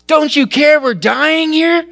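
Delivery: high, loud, slow desperate cry; panicked disciples. Acted. Frightened disciples shouting at sleeping Jesus, loud and high. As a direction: yell it over the storm in terror.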